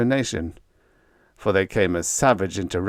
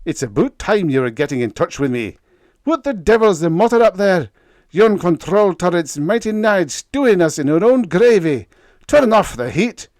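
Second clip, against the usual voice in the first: loud, fast, higher angry rant; angry engineer; 10 semitones higher, 8 dB louder than usual. A narrator's reading. Chief engineer scolding Sparks about the heat, angry. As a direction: chew him out hard. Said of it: "he bellowed angrily".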